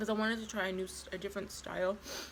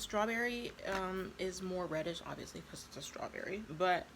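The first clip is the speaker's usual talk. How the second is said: usual speaking voice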